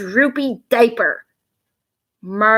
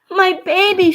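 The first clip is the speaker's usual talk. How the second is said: high, loud frightened cry; alarmed cry; 10 semitones higher, 5 dB louder than usual. Acted. Mother crying out for her baby, high and loud. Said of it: "she cried".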